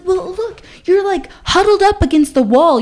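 high-pitched pleading, imitating him; wheedling date. Date argues his way into bed.

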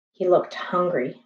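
plain reading voice

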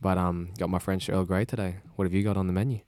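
usual speaking voice

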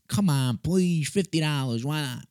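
high, wheedling voicemail plea; mock pleading. Prank caller begs the seller on voicemail, mock pleading.

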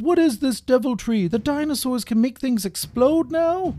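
very high, horrified soldier's cry; panicked outcry. Acted. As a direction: cry out in shocked disbelief. Soldier panics after explosion.

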